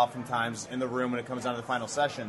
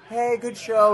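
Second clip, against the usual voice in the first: perfunctory, higher and louder "Hey, good show"; quick polite brush-off; 8 semitones higher, 5 dB louder, slower than usual. Retold or imitated. Parent giving a brief brush-off after a bad show. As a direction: toss it off like a parent who didn't like it. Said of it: "it would be like".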